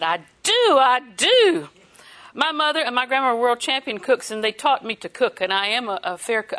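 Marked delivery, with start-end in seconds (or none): 0.0-1.7 second I do louder and higher; eager. Retold or imitated. Wife eagerly offering to bake apple pie, excited. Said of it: "And I said".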